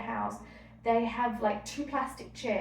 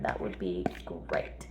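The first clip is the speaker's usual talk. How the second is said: whispered aside. Real, not acted. Scene: a mum wishing aloud her phone had no clock, whispered. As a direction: breathe it out as a private wish.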